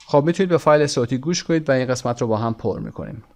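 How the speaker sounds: ordinary talk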